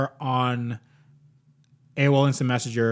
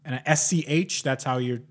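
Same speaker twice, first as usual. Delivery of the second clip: ordinary talk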